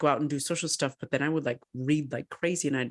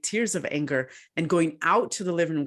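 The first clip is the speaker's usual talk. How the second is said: ordinary talk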